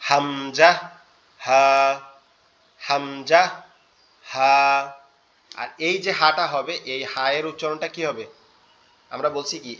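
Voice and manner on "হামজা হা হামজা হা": হামজা and হা voiced from the throat, louder; Arabic hamza and ha; throat letters. Shown as a demonstration. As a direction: feel the throat buzz on হামজা, হা. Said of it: "হাত দিয়ে যদি উচ্চারণ করেন".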